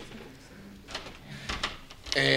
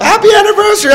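very high, loud squeal; mock gushing congratulations; 12 dB louder, faster than usual. Sister calls up for the anniversary, mock gushing. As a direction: imitate an over-excited caller, pitched way up.

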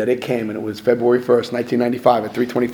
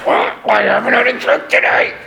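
higher, put-on whiny voice; miserable old-timer; 10 semitones higher than usual. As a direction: mimic a bitter sober man, higher.

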